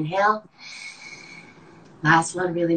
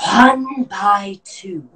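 slow, loud forced counting exhale; lung function test; 3 semitones higher, 8 dB louder, much slower than usual. Shown as a demonstration. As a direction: push the air out while counting. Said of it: "I'm gonna demonstrate real quick".

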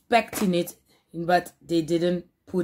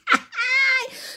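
high giggle. Real, not acted. High laugh.

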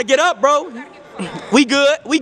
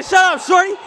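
mock impression of a player; 9 semitones higher than usual. Retelling a player's trash talk, high mocking imitation. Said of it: "He said".

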